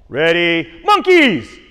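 high, loud game call; called-out command. Real, not acted. Coach calling out jungle game cues to kids, loud high shout. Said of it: "I'm gonna call out".

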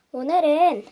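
ordinary delivery

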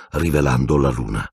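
ordinary delivery, nothing marked

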